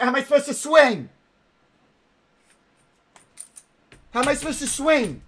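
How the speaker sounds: high, fast, frantic questioning; real high-pitched exasperation; exasperated panic. Real, not acted. Stuck, exasperated at game.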